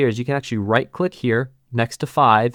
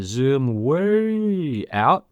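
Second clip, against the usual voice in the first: WAY stretched very long, rising and falling; drawn-out WAY; much slower than usual. Shown as a demonstration. Tutor zooming out the view, WAY drawn out long. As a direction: hold "way" in a long swooping glide.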